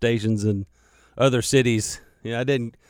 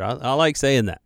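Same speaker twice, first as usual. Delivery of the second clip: ordinary delivery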